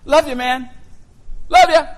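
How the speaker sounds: high, loud, tossed-off mock endearment; mock casual affection. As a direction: toss it out as a glib, showy farewell. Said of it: "love is so casual today".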